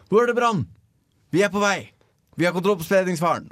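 high-pitched, urgent mock phone operator; super-businesslike dispatcher. Host imitating emergency operator on the phone, super-businesslike and high. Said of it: "være sånn supersaklig over telefon".

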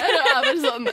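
usual speaking voice

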